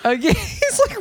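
ordinary delivery

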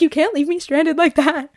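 hammed-up complaint from the one left at the table; mock-pleading boyfriend. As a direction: play the stranded boyfriend, half-joking. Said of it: "He's like".

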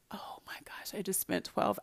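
whispered aside to herself. Retold or imitated. Griping under her breath about twelve hours among cars, whispered. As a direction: breathe it out like a secret complaint. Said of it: "I'm like".

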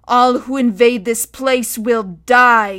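loud, slow, threatening proclamation; booming menace, words drawn out; guttural shout. A narrator's reading. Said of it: "he shouted in his guttural speech".

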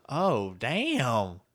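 ordinary talk

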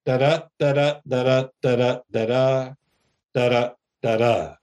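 da-da da-da chanted in steady beats, each second da stressed; meter demo. Shown as a demonstration. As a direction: tap out "Da-da, da-da" like a drumbeat. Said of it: "it sounds like this".

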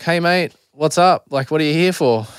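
lightly voiced chirpy psychologist; psychologist's greeting.

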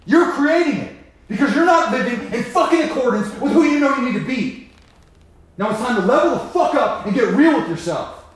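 loud, high, furious rant at himself; shouted tough-love rebuke; fierce self-scolding. Acted. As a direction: tear into yourself like a drill sergeant.

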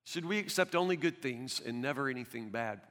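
soft, reflective echo of the line; hushed repeat. Shown as a demonstration. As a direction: let it sink in quietly. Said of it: "quote repeated softly".

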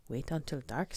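plain reading voice

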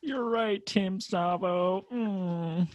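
high, drawn-out fake whimper; mock crying. Playing the defeated rapper in his room, mock crying. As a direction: pretend to concede in tears, slowly. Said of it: "like, just crying".

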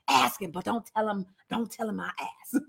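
very soft, hushed mock confiding; secretive. Teacher playing Peter secretly asking John, hushed and secretive. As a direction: slip it out under your breath, secretly.

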